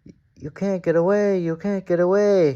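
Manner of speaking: high, fast, teasing sing-song; giggling coaches. A narrator's reading. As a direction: tease like naughty giggling coaches. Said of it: "giggled the coaches".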